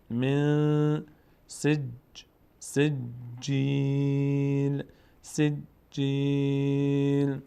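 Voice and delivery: slow drawn-out tajweed chant; Quran recitation. Shown as a demonstration. Teacher reciting the verse for the class. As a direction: recite الم یجعل slowly and evenly.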